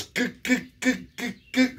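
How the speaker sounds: high stuttered mock old-man voice; stuttering uncle. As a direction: stammer out the kicks in a high put-on voice. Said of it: "he goes".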